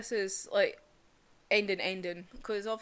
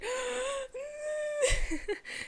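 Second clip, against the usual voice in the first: mock sob gasp. Mimicking a teary quiver.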